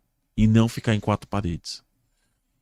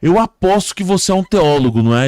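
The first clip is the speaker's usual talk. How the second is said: high, loud exasperated accusation; acted anger; 7 semitones higher, 8 dB louder than usual. Angered victim accusing the rescuer, high and loud. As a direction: accuse him, fed up, as in a joke. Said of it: "o homem ficou com raiva e falou".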